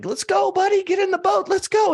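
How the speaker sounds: high, loud rescuer calling out to the stubborn man; retold shouting. Rescuers in a boat shouting at the man on his roof, high and loud. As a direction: pitch it way up and call him into the boat. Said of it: "They're shouting".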